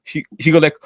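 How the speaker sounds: normal talking voice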